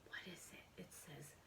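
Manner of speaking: hushed whisper. Real, not acted. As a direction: secret whisper. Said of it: "reads label in hush".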